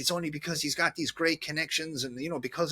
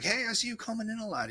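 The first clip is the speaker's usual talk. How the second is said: higher, fast, chummy shopkeeper voice; wine seller quoted; 9 semitones higher, faster than usual. Retold or imitated. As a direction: play the friendly wine guy greeting a regular. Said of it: "he's like".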